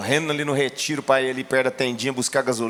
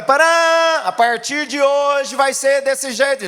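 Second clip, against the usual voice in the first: a herald crying out, much higher and louder, slow; "Pará" rung out like a town crier's call; herald's proclamation; 12 semitones higher, 7 dB louder, much slower than usual. Acted. Pastor acting a herald announcing a new law, loud proclamation. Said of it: "Como o arauto".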